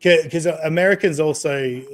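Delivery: ordinary talk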